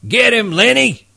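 high, calling-out yell. Acted. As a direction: call out through cupped hands. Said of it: "cupped his hands around his mouth and yelled".

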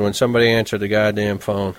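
ordinary delivery, nothing marked

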